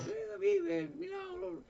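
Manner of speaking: altísimo, chillido de júbilo; grito de alegría imitado. Retold or imitated. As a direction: chilla de alegría como la madre. Madre celebra al hijo vivo. (júbilo agudísimo imitado)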